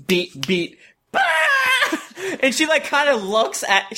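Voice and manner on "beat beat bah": sudden barking laugh; laughing burst. Shown as a demonstration. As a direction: burst out in a laugh after a pause. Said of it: "And it's just like".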